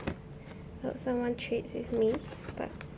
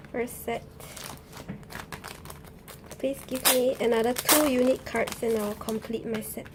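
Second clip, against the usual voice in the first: ordinary delivery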